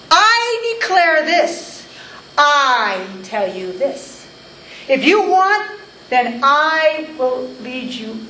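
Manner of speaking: slow, commanding, high and loud declaration; spoken with authority. Acted. Preacher voicing Jesus teaching in the synagogue, slow and commanding. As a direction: proclaim it like one who needs no one's backing. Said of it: "He spoke with authority".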